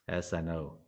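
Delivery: soft, meek little reply; imitating the trader's soft, meek answer; meek admission. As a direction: mumble it like a guilty student. Trader meekly admits it.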